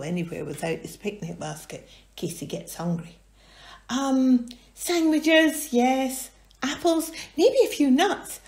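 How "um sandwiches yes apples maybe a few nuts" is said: higher, louder musing list; high thoughtful character voice; Hamish voice. Acted. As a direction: tick off the picnic. Hamish listing picnic.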